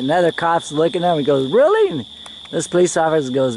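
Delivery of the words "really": high, loud, questioning yelp; acted surprise. Cop watching his colleague smoke, high mock surprise. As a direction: imitate an amazed onlooker, pitched way up. Another cop reacts to smoking demonstration.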